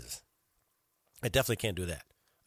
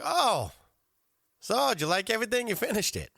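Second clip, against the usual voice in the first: higher-pitched imitation of a courteous owner; polite owner; 10 semitones higher than usual. Owner to complaining diner, imitated high polite voice. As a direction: play the surprised-to-hear-it owner, pitched up. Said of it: "this very, very polite owner".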